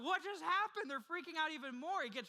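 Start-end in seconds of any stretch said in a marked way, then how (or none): 0.0-0.9 high, loud, alarmed outburst; mock terrified gasp of a question; panicked disciples. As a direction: blurt it out in sudden panic. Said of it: "They're like".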